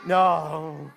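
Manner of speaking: ordinary delivery, nothing marked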